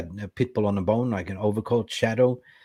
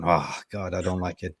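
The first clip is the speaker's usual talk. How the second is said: ordinary delivery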